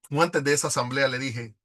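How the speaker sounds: normal talking voice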